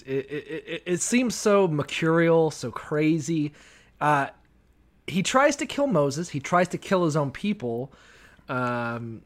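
usual speaking voice

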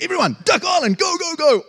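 high, loud call to rush for free ice cream; excited rallying shout. Retold or imitated. Preacher imitating a crowd rushing for free ice cream, loud excited shout. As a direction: yell it like the best news in town. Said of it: "that's the intensity".

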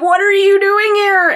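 high-pitched startled question; mock alarm, high; panicked Andy. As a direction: voice a freaked-out man, pitch jumping up. Acting Andy's panicked exchange with Sam.

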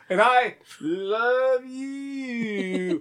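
very slow, drawn-out stiff reply; mock wooden declaration; bad wooden acting. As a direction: overplay the answer like a bad soap actor. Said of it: "really bad at acting".

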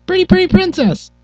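very high sweet princess voice. Acted. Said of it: "The princess voice".

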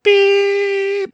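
high, loud, sustained vocal beep; imitated censor beep. Host covering his co-host's workplace name, loud imitated censor beep. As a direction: censor it yourself with one long high beep. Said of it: "We'll just beep that out".

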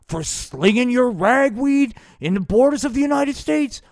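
loud, high puppet Nixon yelling; shouted high cartoon Nixon. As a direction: bellow it like a furious Nixon puppet. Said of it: "was Dick Nixon".

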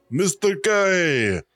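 spooky drawn-out name. Shown as a demonstration.

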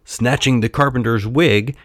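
ordinary delivery

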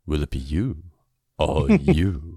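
lightly imitated deep announcer voice; deep radio voice. Podcaster quoting the cartoon's line to co-host, deep radio voice. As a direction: drop into a mock low radio voice. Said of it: "you got the, the deep voice".

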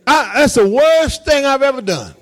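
high, emphatic insistence on WORST; serious insistence. Real, not acted. Pastor insisting he is serious about helping, emphatic. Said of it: "Seriously, I'm serious".

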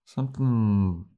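slow, drawn-out, suspicious musing; suspicious slow drawl. Retold or imitated. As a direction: let each word hang slowly, like sensing a trap. Spike's suspicious line, retold.

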